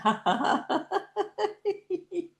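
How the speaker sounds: long high staccato giggle; high exercise laugh; laughter. Shown as a demonstration. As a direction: laugh on purpose until it fades. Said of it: "with a sense of fun and laughter".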